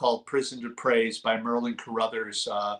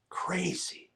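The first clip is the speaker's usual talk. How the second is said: whisper; 4 semitones higher, 10 dB quieter than usual. Real, not acted. Whispered repeat after crazy.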